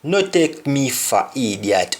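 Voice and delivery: "No take me for idiot" in a Jamaican Patois lilt, "for" as "fa"; a touch of Patois indignation. Shown as a demonstration. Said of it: "an example like".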